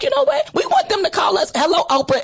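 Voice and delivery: high-pitched excited re-enactment; giddy, high-voiced hello; eager mock phone call. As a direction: pitch it up, playing the keen caller. Acted eager call to Oprah show.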